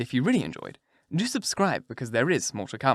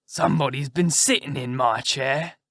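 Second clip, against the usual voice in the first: rough, growly big-bear voice; great rough gruff bear. Acted. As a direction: grumble it out as a huge gruff bear. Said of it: "in his great rough, gruff voice".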